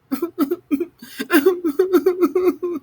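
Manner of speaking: high bubbly laugh; giggle. A narrator's reading. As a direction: narrator laughing at the scene. Narrator laughs at whimpering.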